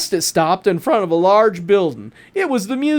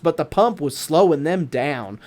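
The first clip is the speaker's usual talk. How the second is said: ordinary delivery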